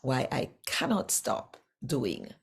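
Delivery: normal talking voice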